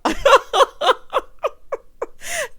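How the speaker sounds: loud, high, helpless laughter; long real laugh. Real, not acted. Host cracking up over handsome young fella, long laugh. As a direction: crack up and let it run.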